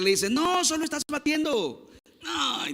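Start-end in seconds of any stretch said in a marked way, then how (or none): 0.3-1.9 high, hushed mock telling-off; scolding father. Father catches his son painting, mock scolding. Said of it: "lo vuelve a regañar". 2.2-2.7 high, soft mimicked whine; disappointed little boy. Little boy told off by his father, mimicked whine. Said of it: "dice el niño".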